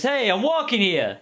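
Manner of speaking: tough New Yorker. Acted. GM voices Jonas grabbing girl.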